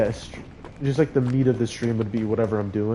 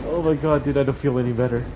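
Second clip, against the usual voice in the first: ordinary talk, nothing marked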